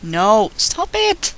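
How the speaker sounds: high, slow, squeaky protest. Real, not acted. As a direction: squeak it out, drawn out slowly. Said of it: "sorry for my squeaky voice".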